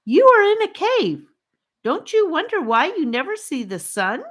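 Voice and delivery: put-on high, earnest boy's voice; Charlie, asking. A narrator's reading. Charlie asks the captives about the sun, in a high earnest boy's voice. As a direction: urge them to think. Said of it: "asked Charlie".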